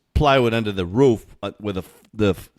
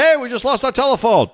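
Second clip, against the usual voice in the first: mock complaint call; 12 semitones higher than usual. Voicing people who lost phone service.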